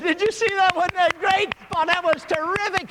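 very high, loud gleeful yells; shouting crowd. Acted. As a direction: yell like a delighted spectator. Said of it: "laughed and shouted and applauded and said".

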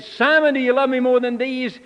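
high and loud, pressing the question; Jesus pressing Simon a third time, loud and urgent; loud, urgent asking. Retold or imitated. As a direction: demand an answer the third time.

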